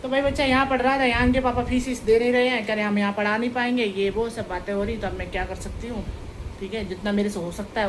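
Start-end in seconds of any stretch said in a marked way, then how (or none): 0.0-3.9 loud, heated complaint; venting. Real, not acted. Woman loudly venting to viewers about the unpaid fees. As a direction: vent the frustration, loudly.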